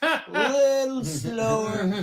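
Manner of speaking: very slow, high and loud; drawn-out slow. Retold or imitated. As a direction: stretch every word right out. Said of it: "Can you say it a little slower".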